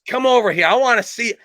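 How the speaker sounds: high, loud coach summons; Nick Saban. Retold or imitated. Coach summons the recruit, loud imitated order. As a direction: call the player over as the coach. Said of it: "Coach Saban said".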